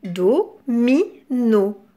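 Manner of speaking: "Do, mi, no" as three isolated beats; split into syllables. Shown as a demonstration. Teacher listening for do, "Do, mi, no" broken apart. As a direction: pause between each part of "Do, mi, no". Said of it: "dans la première syllabe".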